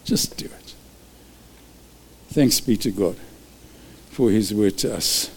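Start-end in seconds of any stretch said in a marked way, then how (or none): 0.1-0.7 breathed Just do it, no voice; whispered final push to the congregation. Acted. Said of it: "closing repeated call".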